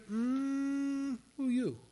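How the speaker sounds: a hint of a suspicious doorkeeper; mild mock challenge at the gate; gatekeeper. Gatekeeper voiced in retelling.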